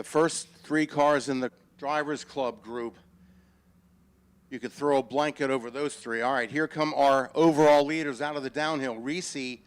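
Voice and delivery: rising excitement, loud live race call; motorsport commentator. Acted. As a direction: call the overtake as it happens.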